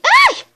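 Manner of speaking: high sudden yelp of pain; sharp cry. Real, not acted. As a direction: yelp as if hurt.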